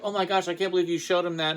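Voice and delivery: imitating a flustered teen; mock freak-out. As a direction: toss off her flustered outburst. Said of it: "flipped out".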